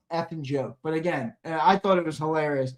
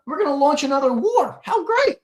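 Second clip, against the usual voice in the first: sarcastic cheer; 10 semitones higher, 3 dB louder than usual. Acted. Sarcastic mock cheer about war.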